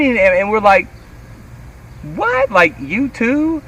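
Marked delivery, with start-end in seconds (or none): 2.1-3.6 high, drawn-out disbelief; retold astonishment. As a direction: squeal it slowly, acting out amazement. Retold disbelief on meeting famous drummer.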